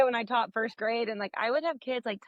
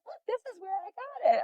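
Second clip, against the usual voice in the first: put-on laughing kid voice; 9 semitones higher, 3 dB quieter than usual. Mother voicing her son.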